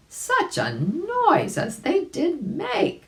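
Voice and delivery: high, slow, wide-eyed wonder; marveling. A narrator's reading. Narrator reading to children, amazed at the splashing noise. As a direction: stretch it out in delight.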